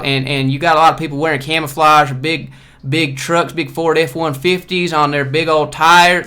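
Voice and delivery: loud, slow Southern Georgia drawl on the trucks; Georgia accent. Retold or imitated. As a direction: lay the Georgia twang on thick. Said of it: "this is kinda where they talk".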